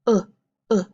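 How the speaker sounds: "Uh, uh" as in hook, twice apart; short OO sound. Shown as a demonstration. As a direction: isolate the vowel of book in "Uh, uh". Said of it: "what makes that uh sound".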